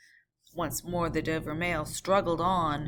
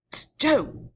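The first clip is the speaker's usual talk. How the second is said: higher, loud warning; 9 semitones higher, 7 dB louder than usual. Acted. Coachman warning the guard, loud and high. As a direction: hiss a sharp warning down. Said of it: "cried the coachman in a warning voice".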